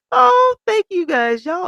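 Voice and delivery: very high, loud, slow gushing thanks; overjoyed thanks. Real, not acted. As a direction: burst out with grateful joy. Thanking viewers for support.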